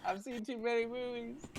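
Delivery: very high voice at normal volume; high-pitched protest. Pretend. Refusing to trust stilt houses, in high mock protest. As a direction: repeat it way up high, mock alarm. Same line repeated much higher.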